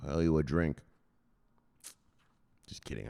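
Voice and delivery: ordinary talk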